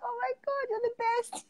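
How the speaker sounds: put-on very high, gushing child-like squeal; little kid. Hugging her pillow like a kid, high childlike delight. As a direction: gush like a delighted kid, softly. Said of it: "like a little kid".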